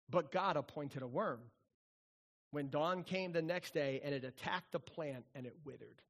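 soft, low reading of the verse; scripture reading. Acted. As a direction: read the verse quietly, reverently. Preacher reads verse.

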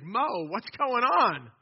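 high, loud, drawn-out demand; loud grumbling. Acted. Thirsty people confront Moses, loudly grumbling. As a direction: call the leader out, stretching the words. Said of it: "the people grumble against Moses".

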